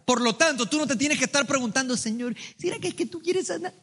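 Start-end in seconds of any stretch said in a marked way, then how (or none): 2.0-3.7 high, soft doubting plea; mock doubter pleading with God in a small high voice; timid plea. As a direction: imitate a hesitant believer, much higher and softer.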